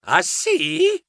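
high rising astonished question; stupore. A narrator's reading. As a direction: ask wide-eyed, taken aback. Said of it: "Io sgranai gli occhi e dissi". (domanda acuta e stupita)

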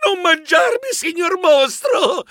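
very high, pleading voice; theatrical frightened wail; terrified cry. A narrator's reading. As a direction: plead with a monster in a panicky squeal. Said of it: "Io gridai, terrorizzato".

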